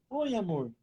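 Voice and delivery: softened, tender answer to her husband; sweet tender reply. Retold or imitated. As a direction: switch instantly to a sweet loving tone. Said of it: "Muda na hora. O tom de voz". (resposta doce e carinhosa ao marido)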